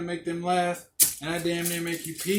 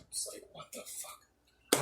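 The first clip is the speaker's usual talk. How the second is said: a breathy whispered what the fuck; whispered disbelief; 13 dB quieter, slower than usual. Real, not acted. Whispering in disbelief after a stranger calls him a troll. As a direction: drop to a stunned whisper. Reacting to being called a troll.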